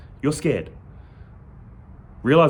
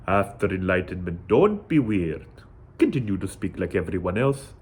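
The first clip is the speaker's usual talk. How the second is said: lightly imitated Indian accent, lower pitch; a hint of an Indian monk's accent, calm and lower; 7 semitones lower than usual. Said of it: "Orange robe, Indian accent".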